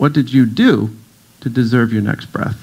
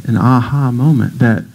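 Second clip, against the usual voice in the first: ordinary talk, nothing marked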